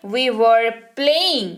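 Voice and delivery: very slow, careful model sentence; very slow dictation. Shown as a demonstration. Teacher dictating to the class, very slow. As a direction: drag each word out for learners. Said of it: "teacher models English sentence".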